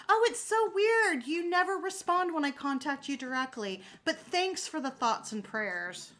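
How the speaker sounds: passive-aggressive. Pretend. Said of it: "I would be completely passive-aggressive".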